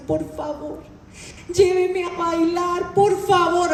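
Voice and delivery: slow, drawn-out begging; pleading wife. Retold or imitated. Wife begging her husband to take her dancing, slow pleading. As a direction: whine it out slowly like begging to go dancing. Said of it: "Oiga, le lloraba".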